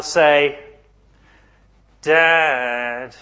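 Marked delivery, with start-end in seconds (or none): 2.0-3.2 "Dad" stretched into two syllables, let down; a child whose father let them down, dragging "Dad" out; disappointed "Dad". Shown as a demonstration. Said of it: "Two syllables".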